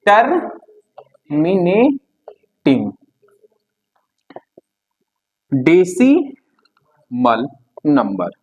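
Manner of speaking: very slow, drawn-out dictation for notes. Shown as a demonstration. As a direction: stretch each word for writing. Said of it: "teacher dictating term". (धीमी बोलकर लिखवाना)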